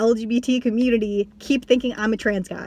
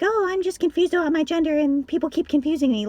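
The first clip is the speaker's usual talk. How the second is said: a higher, put-on voice parodying confusion; mocking quote; 6 semitones higher than usual. Mocking people who assume she is confused, high put-on parody. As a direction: send up the idea that she is confused.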